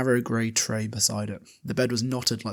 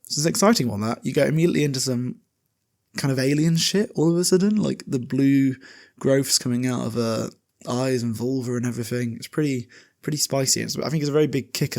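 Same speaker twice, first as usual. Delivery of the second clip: excited; 3 semitones higher, 4 dB louder than usual. Real, not acted. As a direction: gush about the twist you loved. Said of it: "it's exciting".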